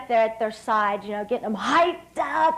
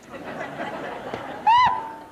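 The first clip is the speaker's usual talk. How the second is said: airy breathy giggle; a real little laugh; light laugh. Real, not acted. Laughs at coach's remark.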